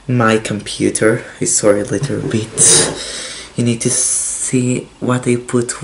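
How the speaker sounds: usual speaking voice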